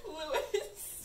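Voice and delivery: a moan spoilt by laughter; giggled mock moan. A narrator's reading. Zane moaning to Lewis, reader giggling through it. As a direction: attempt a moaned name, cracking up. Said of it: "Zane moaned".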